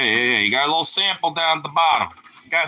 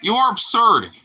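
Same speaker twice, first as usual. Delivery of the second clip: high exclaim; 10 semitones higher than usual. Real, not acted. Exclaiming at the dog.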